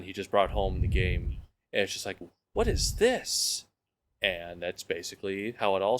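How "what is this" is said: high, slow, awestruck question; imitating an amazed first look; retold amazement. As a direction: draw it out high in wonder. Retold amazement at new game.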